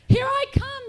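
put-on high, very loud baby announcing arrival; big triumphant holler; yelled. A narrator's reading. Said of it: "yelled baby".

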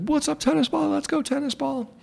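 high-pitched mocking taunt; heckling guy's voice. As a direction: imitate an obnoxious fan in a high voice. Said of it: "this guy's voice became, like, a trigger".

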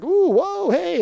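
high, loud mock whooping; retold startled reaction. Helpers hearing strange poems, mock startled.

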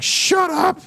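sharp high yell of shut up; retold shout at the kid, very high. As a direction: bark it like a drill sergeant. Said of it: "fucking yelling at him".

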